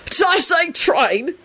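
high, caught-out question; flustered high voice; babbled backtracking. A narrator's reading. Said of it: "Sirius babbled quickly".

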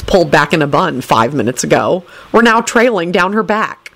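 ordinary delivery

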